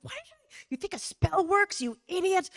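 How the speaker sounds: high mocking voice; mock-shrill self-insult; self-mocking scold. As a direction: scold yourself in a silly voice. Mocking herself.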